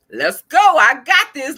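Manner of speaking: loud, high, pumped call to go; an excited kid in tour guide mode; eager tour guide. Retold or imitated. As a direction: lead the way like a keen tour guide. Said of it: "he was just like".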